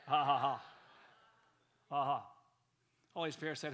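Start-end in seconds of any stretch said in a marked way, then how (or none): 0.1-2.3 louder staged laughter; mock laugh. Preacher leads the congregation in laughing at a lie. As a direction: laugh on cue, mocking the excuse. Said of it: "Let's laugh at that".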